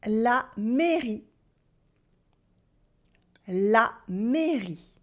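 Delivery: "La mairie" said slowly, stretching the é; slow teacher demo of "La mairie"; slow clear demo. Shown as a demonstration. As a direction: draw the word out for learners. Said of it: "find out if I pronounce the sound E".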